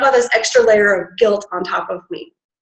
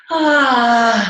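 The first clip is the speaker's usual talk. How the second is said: ah out breath. Shown as a demonstration. Coach showing the exhale noise. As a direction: sigh out an open ah. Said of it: "like that, again".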